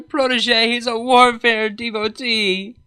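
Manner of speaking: a mentor bewailing her student's love of war; despairing. A narrator's reading. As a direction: mourn your own failure out loud.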